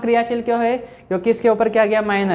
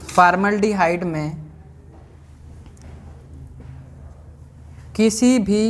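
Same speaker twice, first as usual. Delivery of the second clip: slow, word-by-word dictation with long pauses; classroom dictation; slower than usual. Acted. Teacher reading out notes for students to write, measured dictation. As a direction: dictate in small, evenly stretched chunks.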